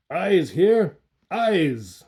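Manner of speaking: loud, roaring demand; dull roaring voice. A narrator's reading. Coppelius demanding eyes, dull roaring voice. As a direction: roar it out, dull and heavy. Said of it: "in a dull, roaring voice".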